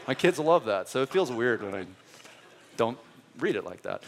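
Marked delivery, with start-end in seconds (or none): none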